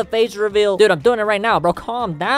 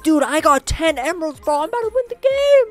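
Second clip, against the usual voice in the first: squeaky mock tryhard gamer, higher; mock-bragging tryhard player voice, higher; 9 semitones higher than usual. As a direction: mimic a smug kid bragging. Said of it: "He was like".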